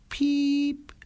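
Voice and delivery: a tiny, very high birdlike peep; bird chirp. Acted. Titmouse peeping back at the postman from the mailbox. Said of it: "antwortete es".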